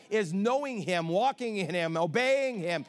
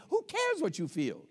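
usual speaking voice

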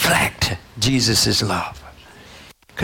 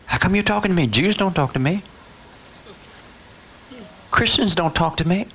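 higher-pitched, puzzled challenge; acted incredulity; 7 semitones higher, faster than usual. Samaritan woman questioning Jesus, higher and incredulous. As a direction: question it as if it makes no sense.

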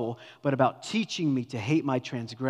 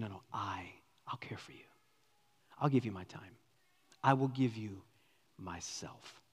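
soft intimate; 3 semitones lower, 6 dB quieter than usual. Acted. Joseph's intimate offer voiced.

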